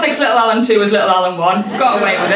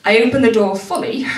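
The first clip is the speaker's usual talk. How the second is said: usual speaking voice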